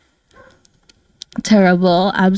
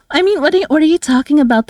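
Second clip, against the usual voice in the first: mock offended; 6 semitones higher, much faster than usual. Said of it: "This is my natural voice".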